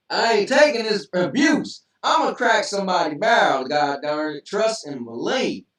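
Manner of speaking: loud, high-pitched hammed-up outrage; mock indignant outburst. Mock outburst at being teased.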